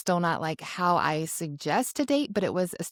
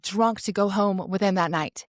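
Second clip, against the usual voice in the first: usual speaking voice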